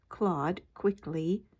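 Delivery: plain reading voice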